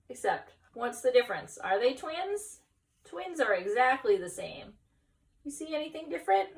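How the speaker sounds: plain reading voice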